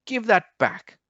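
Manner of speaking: high squeaky protest; squeaky squirrel. A narrator's reading. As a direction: squeak it out like a small indignant squirrel. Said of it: "squeaked the squirrel".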